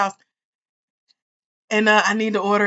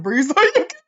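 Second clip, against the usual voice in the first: laughing quote; 8 semitones higher, 6 dB louder, faster than usual. Retold or imitated. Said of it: "She said".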